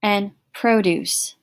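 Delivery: slow PRO-duce, stress on the first syllable; noun produce. Shown as a demonstration. Teacher contrasting the noun for the class, stress on the first syllable. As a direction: say "produce" as PROH-dooce, first syllable leaned on. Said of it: "Slightly different".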